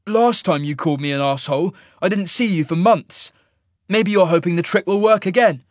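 loud, high petulant accusation. A narrator's reading. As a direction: throw the old grievance back at them. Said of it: "he replied petulantly".

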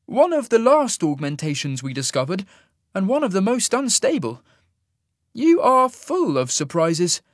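high-pitched, impressed immortal; impressed wonderment. A narrator's reading. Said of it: "sounding impressed".

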